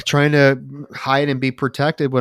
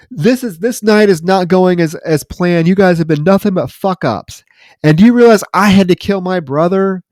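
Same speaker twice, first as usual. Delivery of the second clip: loud, high mock tirade; retold angry rant; 8 semitones higher, 7 dB louder than usual. Felix berating his hired killers for botching the plan, acted angry rant. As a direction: imitate an exasperated boss chewing out his crew.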